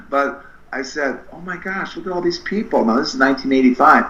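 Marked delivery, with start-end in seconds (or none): 1.3-2.8 high, wondering exclamation; excited amazement. Retold or imitated. Seeing the crowd at the mall, high excited wonder. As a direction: marvel at the crowd.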